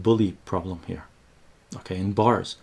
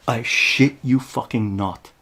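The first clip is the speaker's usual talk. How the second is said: usual speaking voice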